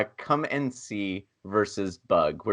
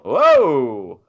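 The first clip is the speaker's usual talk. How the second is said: surprised; 8 dB louder than usual. Real, not acted. Surprise at an unexpected vote.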